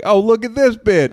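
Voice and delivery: mock mean mom. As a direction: sneer at the bride. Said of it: "was like".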